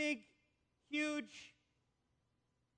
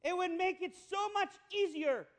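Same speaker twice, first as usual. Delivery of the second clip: voicing Olga; 4 semitones higher, 8 dB louder than usual. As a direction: urge him on like a pushy church lady, a bit higher. Woman urging Oli in joke.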